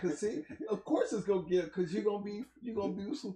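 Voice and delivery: high excited voice. Real, not acted. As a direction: hype it up. Host hyped at loud cohost.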